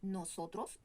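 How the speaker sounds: "Nosotros" with every O fully sounded; clear O. Shown as a demonstration. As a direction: round out each O in "Nosotros". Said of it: "On prononce bien la O".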